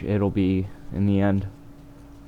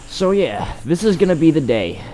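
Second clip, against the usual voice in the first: excited; 10 semitones higher, 7 dB louder than usual. Acted. Hyped announcement, then joke.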